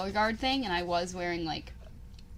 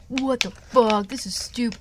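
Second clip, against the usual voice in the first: mildly angry, louder mock outburst; retold pissed-off; 3 semitones higher, 6 dB louder, slower than usual. Girl retelling a rejected boy's reaction, mock pissed-off. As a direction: imitate an indignant boy, louder. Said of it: "got, like, kinda pissed at me".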